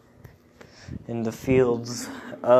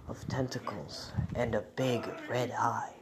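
whispering; 8 dB quieter than usual. Acted. Whispered eerie detail.

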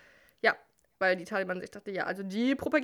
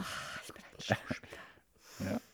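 whispered aside; 9 dB quieter, much slower than usual. Real, not acted. Aside after acting.

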